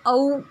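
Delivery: औ drawn out as a clear open au; teacher models the औ sound; औ vowel sound. Shown as a demonstration. Teacher shows pupils how औ sounds. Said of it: "इस प्रकार किया जाता है".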